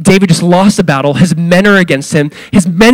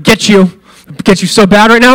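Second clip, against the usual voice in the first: mock angry threat; 4 semitones higher, 4 dB louder than usual. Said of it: "this judgmental tone".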